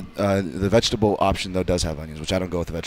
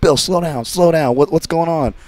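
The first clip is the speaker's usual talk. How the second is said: raised-pitch imitation of a worried boss; alarmed boss; 8 semitones higher, 4 dB louder, slower than usual. Boss calming a freaked-out worker, high urgent mock voice. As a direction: urgently try to calm someone down, acted. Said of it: "And the bosses".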